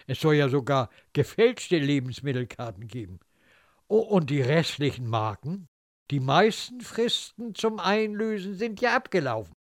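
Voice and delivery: ordinary delivery, nothing marked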